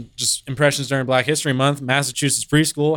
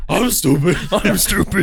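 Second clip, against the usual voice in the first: a hint of a muppet grouch; sounding like Oscar; 2 semitones higher than usual. Repeating the friend's impression, laughing, a hint of Oscar. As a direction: mimic the dopey line, laughing. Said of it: "I sound like Oscar".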